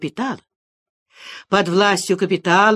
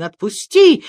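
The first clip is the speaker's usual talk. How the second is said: higher, louder demand; protesting cry; 5 semitones higher, 6 dB louder than usual. A narrator's reading. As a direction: cry out to be let go. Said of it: "кричал Саранин". (громкий крик с требованием)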